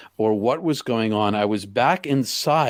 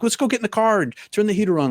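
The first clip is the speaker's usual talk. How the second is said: higher, fast and eager; eager suggestion; 10 semitones higher, much faster than usual. Retold or imitated. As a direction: rush toward the warm car. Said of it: "He's like".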